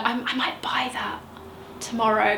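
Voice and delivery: confiding whisper to herself; whispered aside. Real, not acted. Vlogger admits she might rebuy it, whispering. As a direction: drop to a secret whisper, half to herself. Aside about buying perfume again.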